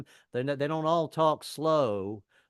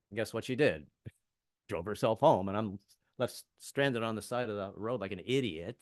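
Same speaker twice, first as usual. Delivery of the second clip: normal talking voice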